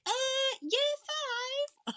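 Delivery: very high sing-out teacher impression; high-pitched primary school voice. Singer mimicking teachers addressing kids, high-pitched primary school voice. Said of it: "in that high-pitched primary school voice".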